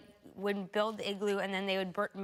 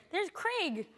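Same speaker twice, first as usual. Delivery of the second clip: higher-pitched mock spotting cry; imitated onlooker's call; 10 semitones higher than usual. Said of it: "you hear someone, there's, like".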